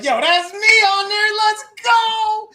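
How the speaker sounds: high, loud, drawn-out excited cheer; mock-thrilled, stretched-out yell; hyped-up reaction. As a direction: crow it like a proud amateur hearing themselves. Acted excited reaction to old recording.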